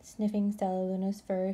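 ordinary delivery, nothing marked

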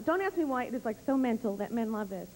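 normal talking voice

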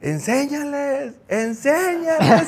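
very high, slow, drawn-out cheer; excited fan yell. Retold or imitated. Excited fan urging the goalkeeper as he jumps, high drawn-out yell. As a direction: stretch each word out like a thrilled fan. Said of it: "el señor empieza".

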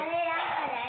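loud call to a teammate. Real, not acted. Teammate calling out in game.

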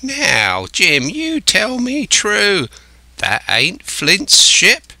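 slow, high, stretched-out warning; threatening castaway. A narrator's reading. Castaway demanding truth from Jim, threatening. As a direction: demand the truth slowly, pitch climbing. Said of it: "threateningly before my eyes".

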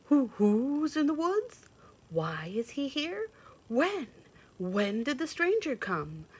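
high, loud hooting owl voice; curious owl. Acted. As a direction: hoot out anxious questions like an owl. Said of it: "asked the owl of many questions".

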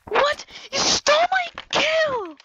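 high, loud indignant shout; mock outrage. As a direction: cry foul, high and loud. Outraged at stolen kill.

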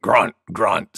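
low-pitched boar voice; grunting boar. A narrator's reading. Wild Boar joining the sneezing contest, low grunting voice. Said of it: "said Wild Boar".

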